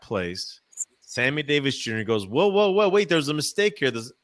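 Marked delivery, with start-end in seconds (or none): 2.3-3.9 mock alarm, pitched up; acted halt, high and louder at first; urgent interruption. Singer stopping his show on cue, mock alarmed.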